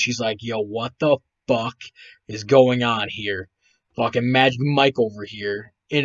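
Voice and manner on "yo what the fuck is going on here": ordinary talk, nothing marked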